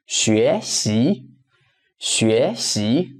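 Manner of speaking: "学习" with both syllables rising; carefully modelled double rise on "学习"; two second tones. Shown as a demonstration. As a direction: lift 学, then lift 习. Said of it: "second tone, second tone".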